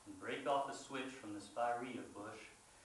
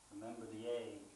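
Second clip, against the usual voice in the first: quiet threat; 3 semitones lower, 8 dB quieter than usual. A narrator's reading. Brother's quiet blackmail of narrator.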